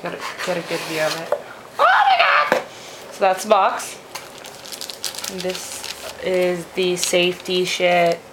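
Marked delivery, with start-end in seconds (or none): 1.8-2.6 very high, loud delighted shriek; excited shriek. Real, not acted. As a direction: shriek with sheer joy. Sees contents of box.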